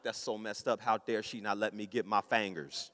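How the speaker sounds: low, soft grumbling to oneself; sulky inner grumble. Retold or imitated. Husband still sore at wife over the fingers, low grumble. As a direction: grumble it under your voice, low. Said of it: "back in my mind".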